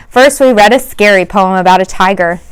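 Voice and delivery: usual speaking voice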